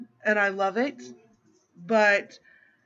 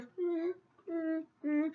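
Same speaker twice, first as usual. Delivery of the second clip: soft, high little cuddly hums; small high "mm" sounds, squeezing a hug; imitated hugging hum; 6 semitones higher, 11 dB quieter, slower than usual. Little son hugging mom after trouble, soft imitated hums. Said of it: "he comes and hugs you like".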